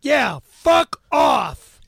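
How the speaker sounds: furious shout, cracking and slow; screaming fuck off at the host; angry yell. Acted. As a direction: yell it out in rage. Angry outburst at cohost.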